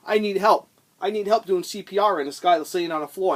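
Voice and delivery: loud, high pleading for help; imitating a desperate customer; hammed-up emergency cry; mock urgent plea. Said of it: "'Cause if I said".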